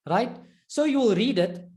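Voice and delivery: ordinary talk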